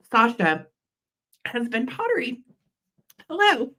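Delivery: talking through a real coughing fit; choked by a cough. Real, not acted. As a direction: squeeze the words out through a throat tickle. Coughing fit while presenting.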